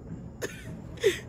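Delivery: breathy high-pitched laugh; quick airy laugh at herself; a short, light, unvoiced giggle; soft high laugh. Real, not acted. Laughs at own dramatic question.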